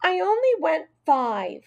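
put-on high, heated child's voice; high-pitched indignant protest; shouted protest. A narrator's reading. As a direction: defend yourself against the accusation. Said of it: "Heidi shouted".

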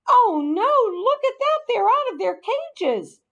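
high, loud dismay; alarmed woman. A narrator's reading. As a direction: exclaim in shock at the mess. Woman startled by escaped pets.

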